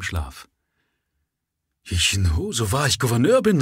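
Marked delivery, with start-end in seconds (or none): 1.8-3.6 raised, louder and higher outburst; indignant call. A narrator's reading. Governor woken at night by the prisoner, indignant call. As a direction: cry out in startled outrage. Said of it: "rief der Gouverneur".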